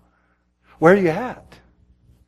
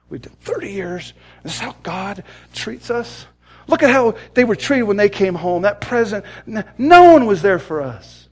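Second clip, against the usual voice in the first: higher, building to a loud finish; fed-up complaint swelling louder; 4 semitones higher, 4 dB louder than usual. Retold or imitated. Missionary complains to his wife about God's treatment, fed up. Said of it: "is just fed up".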